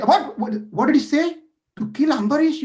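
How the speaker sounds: high, loud shocked what; shocked disbelief. Acted. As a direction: shriek your disbelief at the news. Shocked at murder plan.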